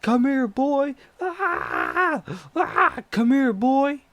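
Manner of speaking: high, loud, drawn-out yell; slow high-pitched crazed shout, mimicked; imitated screaming pursuer. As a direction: holler after someone you are chasing. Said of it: "And he's screaming".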